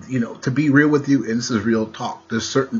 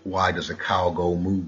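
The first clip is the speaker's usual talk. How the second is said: usual speaking voice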